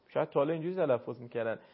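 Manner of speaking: ordinary delivery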